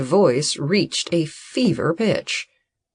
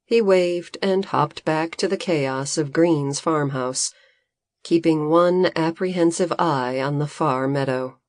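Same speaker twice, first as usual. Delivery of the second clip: usual speaking voice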